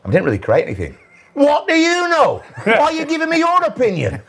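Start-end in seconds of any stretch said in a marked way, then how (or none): 1.3-4.2 hammed-up boss taking offence; mock outraged manager. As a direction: squeal it like the indignant boss. Manager outraged at his opinion.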